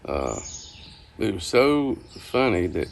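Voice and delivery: ordinary delivery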